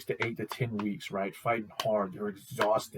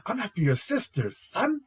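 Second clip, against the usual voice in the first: high, loud mocking taunt; cocky taunt; 12 semitones higher, 5 dB louder than usual. Isaac taunting his rival, high and loud. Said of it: "It's like".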